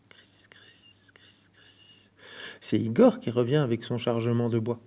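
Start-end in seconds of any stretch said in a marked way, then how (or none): 0.1-2.1 barely breathed criss sounds; whispered crunch. A narrator's reading. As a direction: whisper the footsteps in the snow. Footsteps crunching in snow.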